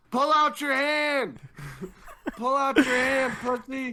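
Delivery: put-on high, loud drunken jeering with a laugh; drunk taunt. Drunk Tetra taunting Yonkers at the arm-wrestling table, loud and laughing. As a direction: yell it like a drunk egging on a rival. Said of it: "Tetra's kinda drunk".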